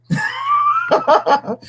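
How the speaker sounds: very high, long laugh; cackling. Real, not acted. Host recalling the first sight of it, cackling. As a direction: crack up in a squealing cackle. Cracks up after oh my God.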